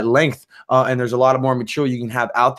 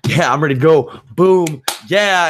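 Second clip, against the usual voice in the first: louder, higher burst of hype; pumped up; 5 semitones higher, 5 dB louder, slower than usual. Retold or imitated. Describing a hormone rush to listeners, pumped hype. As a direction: fire yourself up like the heart is racing. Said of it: "That heart rate pumping".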